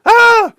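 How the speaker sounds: short high loud mock shriek; mock panicked scream. Commentator mimics claustrophobic agoraphobe journalists, high mock shriek.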